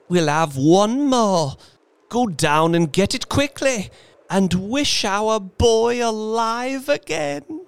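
put-on high, urgent old woman, slowing at the end; triumphant. A narrator's reading. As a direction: urge it on with feverish triumph. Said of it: "she cried triumphantly".

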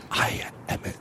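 hushed, breath-only singer's whisper; voice-saving whisper. Acted. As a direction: whisper to spare your precious voice. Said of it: "tell people you're a singer".

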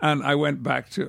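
usual speaking voice

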